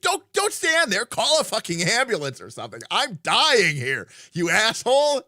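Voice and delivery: high, loud outraged yell; furious shout. Retold or imitated. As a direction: scream at him to help. Dying villain yells at hero.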